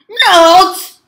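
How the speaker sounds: very loud, high, stretched note; shouted jump command. Real, not acted. Player making the game character jump, shouting high. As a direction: belt one long high syllable.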